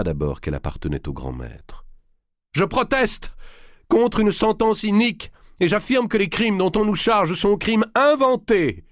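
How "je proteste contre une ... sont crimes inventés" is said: cri de protestation très haut et fort; voix puissante. A narrator's reading. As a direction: lancer la protestation du haut des marches. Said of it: "cette voix était si puissante". (le grand maître proteste devant le tribunal, voix puissante)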